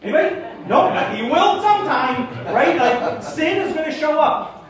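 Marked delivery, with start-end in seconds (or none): none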